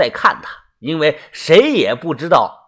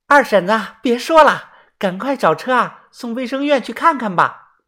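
higher-pitched persuading voice; urging village woman; 7 semitones higher than usual. A narrator's reading. Village woman talks the old mother round, high urging voice. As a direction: coax her to stop and go. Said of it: "也有妇女劝导啊".

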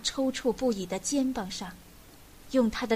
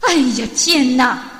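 very loud outcry; exasperated shout; 12 dB louder than usual. A narrator's reading. Old man kicking the fireplace, shouting in exasperation. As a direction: cry out in angry dismay. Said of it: "老人一边嚷".

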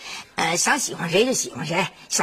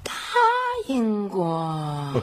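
pondering; 2 semitones lower, much slower than usual. Acted. Mother trying to recall a promise.

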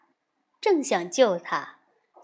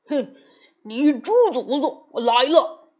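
bold shouted boast, louder; loud call; 7 dB louder than usual. A narrator's reading. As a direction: shout it out proudly at the demon. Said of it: "高叫道".

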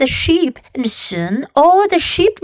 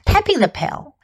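ordinary delivery, nothing marked

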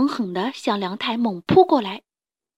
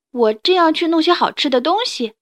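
bright and higher, a touch louder; cheerful reply; 6 semitones higher, 4 dB louder than usual. A narrator's reading. Liangtai happily tells the tyrannosaurus his plan, cheerful. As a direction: answer gladly, thinking of red fruit. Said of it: "梁太笑嘻嘻地回答".

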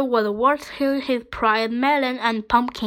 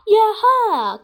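high, loud cheer; drawn-out joyful holler; whooping call; 10 semitones higher, 7 dB louder than usual. A narrator's reading. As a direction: whoop in triumph. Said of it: "called Henry".

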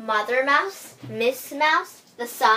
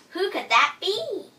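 put-on high, small young mouse voice; little Miss Mouse voice; 8 semitones higher, 4 dB louder than usual. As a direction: ask it like a tiny curious mouse. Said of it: "asked Miss Mouse".

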